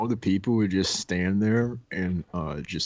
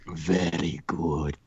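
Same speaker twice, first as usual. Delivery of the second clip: slow, lower mock-accented English; solemn sensei-like accent, half-speed and low; imitated master's accent; 4 semitones lower, much slower than usual. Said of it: "looks up at me like this and goes".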